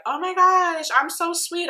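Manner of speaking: high, put-on sweetie voice; mock confused sweetness. Vlogger mimicking the star who denies her jabs, fake sweet. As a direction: imitate someone acting sweet and clueless. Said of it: "like, confused and be like".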